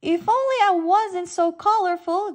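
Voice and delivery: gasping. A narrator's reading. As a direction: gasp it out in fright. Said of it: "gasped Angelfish".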